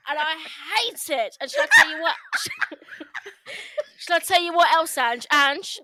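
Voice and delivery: very loud, high, building indignation; outraged venting played for laughs; comic angry rant. Real, not acted. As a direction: rant at full tilt, close to laughing. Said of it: "Angered. So I'll try".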